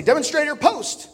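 high, loud drill order; army command. Retold or imitated. Former soldier imitating an army drill call. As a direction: call the demonstrator to his post. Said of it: "in the Army, they'd say".

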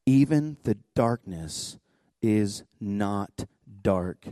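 slow, measured reading with long pauses; solemn scripture reading. Acted. Reads the Psalm aloud.

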